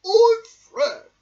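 very high, loud squeaky bear voice; high-pitched cartoon bear; squeaky toy bear. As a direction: pipe up like a little toy bear. Fred the bear speaks.

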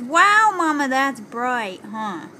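put-on toddler voice. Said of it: "and then she looks over here and says".

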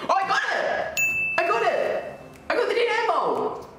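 very high, gleeful squeal; high-pitched winner's cheer; excited celebration. Real, not acted. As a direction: squeal it out in triumph. Celebrating winning the prize.

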